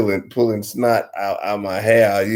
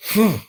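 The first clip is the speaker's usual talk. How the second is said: snort blow; 14 semitones higher, 4 dB louder than usual. Shown as a demonstration. Demonstrates the snot blow sound.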